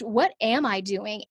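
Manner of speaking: ordinary talk, nothing marked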